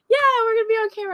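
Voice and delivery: high, rapid mock reply; imitating co-host. Guest mimics the host confirming the camera plan, imitating co-host. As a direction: rattle it off high, mimicking him. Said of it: "And you're like".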